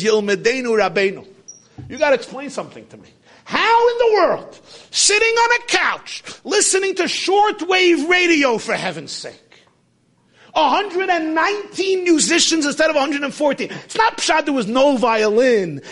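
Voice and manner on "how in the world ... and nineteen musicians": amazed disbelief. Retold or imitated. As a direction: marvel at it like it's impossible. Biographer amazed at Toscanini.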